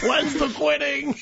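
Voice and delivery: high, slow impatient whine; eager client voice. Pretend. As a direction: whine for the quitting. Mock impatient gym client.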